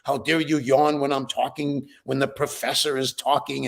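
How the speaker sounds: ordinary talk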